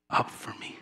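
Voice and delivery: trailing into a whisper. Real, not acted. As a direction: whisper the last words. Verse ends in whisper.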